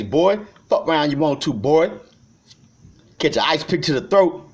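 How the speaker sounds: spitting threats, voice up; angry rant. Real, not acted. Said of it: "I'm going off and melting down".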